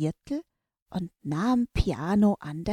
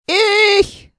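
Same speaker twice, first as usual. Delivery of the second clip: loud, much higher shout; 13 dB louder than usual. Acted. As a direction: shout it eagerly. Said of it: "rief daraufhin Forte ganz laut". (laut und hoch gerufen)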